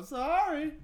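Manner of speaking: high, loud little apology; mock apology. Friend imitating the drivers after the crash, high mock apology. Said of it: "they go".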